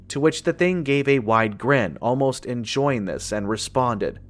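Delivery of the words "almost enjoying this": usual speaking voice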